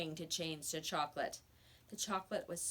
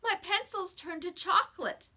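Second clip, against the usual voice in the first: high, louder desperate plea; begging boy; 11 semitones higher, 6 dB louder than usual. A narrator's reading. Boy tells teacher his pencil changed, pleading. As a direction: blurt out the bad news. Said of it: "he begged in a loud whisper".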